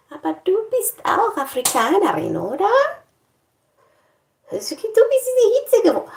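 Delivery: high teasing imitated voice; mock German asking an African woman; mock questioner. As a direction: mimic the nosy question. Imitating someone asking her.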